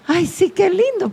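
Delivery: high, soft, mock-sweet coo; insincere gushing. Preacher showing how the voice betrays false praise, insincere gushing. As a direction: feign delight in a high little voice. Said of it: "la voz transmite la verdad".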